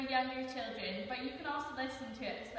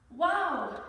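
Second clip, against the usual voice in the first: loud, swooping wow of wonder; amazed; 5 semitones higher, 10 dB louder than usual. Acted. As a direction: exclaim it, awestruck by the sight. Carlo amazed at seeing books.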